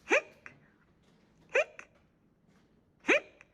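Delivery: hiccup between tries; hiccuping hippo. A narrator's reading. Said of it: "I have hiccups".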